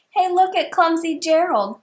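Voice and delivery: sneering. A narrator's reading. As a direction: jeer at the clumsy giraffe. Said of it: "the animals all sneered".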